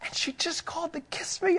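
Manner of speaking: mock secret bragging to the roommates; hushed excited brag. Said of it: "I didn't say it like that".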